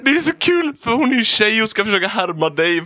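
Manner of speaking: helpless high-pitched laughing speech; talking while cracking up; laughing hard. Real, not acted. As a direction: squeal it out through uncontrollable laughter. Can barely talk for laughing.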